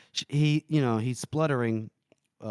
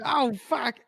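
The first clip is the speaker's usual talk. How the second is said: mock alarm; 13 semitones higher than usual. Everyone reacting in alarm.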